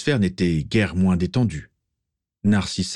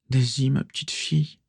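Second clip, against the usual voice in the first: lightly voiced caring mother; tender; 6 semitones higher than usual. A narrator's reading. Mother figure over injured girl.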